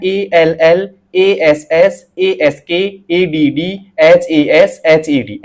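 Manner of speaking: each word spelled letter by letter, एएलएल slowly. Shown as a demonstration. Teacher shows students how to read the practice words: spelled letter by letter. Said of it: "ऐसे पढ़ना है दोस्तों आपको".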